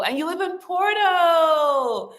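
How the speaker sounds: usual speaking voice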